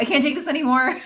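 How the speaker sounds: high, laughing retold outburst; exasperated quote breaking into a laugh; mock exasperation. Fed-up friend bailing on the conversation, mock exasperation. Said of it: "Ben is just like".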